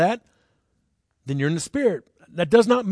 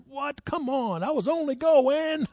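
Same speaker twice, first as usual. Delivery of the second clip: higher, whiny mock protest to the cop; 10 semitones higher, slower than usual. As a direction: play the indignant driver, half laughing. Mock protest to police officer.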